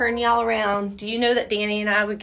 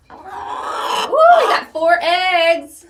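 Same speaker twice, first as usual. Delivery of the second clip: excited; 8 semitones higher, 5 dB louder, much slower than usual. Real, not acted. Finding eggs in coop.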